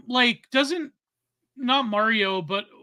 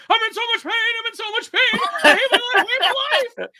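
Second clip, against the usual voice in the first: much higher, hammed-up wailing meltdown; Boogie freaking out; 13 semitones higher, 5 dB louder, faster than usual. Co-host imitating a streamer's live meltdown, high mock wail. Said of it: "freaking out on Lolcow Live".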